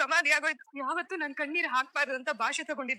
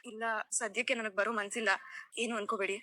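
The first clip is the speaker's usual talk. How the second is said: subdued sad refusal; 5 semitones lower, 5 dB quieter than usual. Acted. Grieving girl declines shopping.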